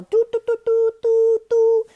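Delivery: silbido imitado, muy agudo y fuerte; imitando un silbato. Retold or imitated. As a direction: pita como un silbato de guardia. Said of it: "alguien pitando así". (imitación aguda de un pitido)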